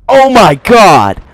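high, loud, drawn-out cry; outraged shout. Real, not acted. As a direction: shout it in slow disbelief. Caught out in the game.

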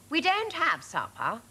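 a hint of a snooty dog; first Labrador. A narrator's reading. Labrador telling Dad there is no supper, lightly voiced. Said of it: "said the first Labrador".

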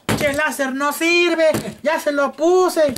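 high, slow complaining customer voice; whiny customer. Pretend. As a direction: gripe to the shop clerk. (cliente quejumbroso, agudo y lento)

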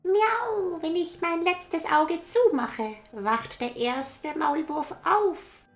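very high, loud cat; a playful little cat. Acted. Cat speaks.

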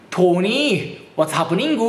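drawn-out Irish lilt with a rising, stretched end; thick Irish accent. Retold or imitated. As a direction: imitate a strongly Irish-accented girl calling out. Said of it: "むちゃくちゃなまってて". (きついアイルランド訛りで語尾を伸ばす真似)

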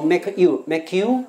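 "make you" said as one word, "may-kyoo"; linked words. Shown as a demonstration. Teacher correcting how "make you" links, run together. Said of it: "要连起来".

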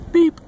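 high, loud short horn beep; perky high-pitched beep; little truck beep. A narrator's reading. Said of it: "Blue said".

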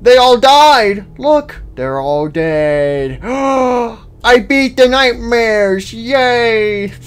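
high, loud, slow happy exclaims; overjoyed. Real, not acted. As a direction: savour the win, overjoyed. Celebrates beating the nightmares.

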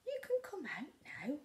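hint of coaxing whisper. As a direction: coax them gently. Percy coaxes.

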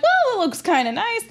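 high, forced enthusiasm; fake excited. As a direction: pretend to like it, chirpy. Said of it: "I'm like".

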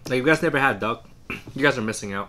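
speaking between bites; talking while chewing. Real, not acted. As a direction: mouth partly full of food. Seen 0: Man bit bun at -2, still chewing while talking after.

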